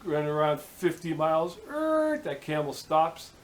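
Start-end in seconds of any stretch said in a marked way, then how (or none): 1.7-2.2 high-pitched imitated brake screech; drawn-out high "errr" of a sudden stop; screeching-halt noise. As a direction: squeal it like tires stopping dead.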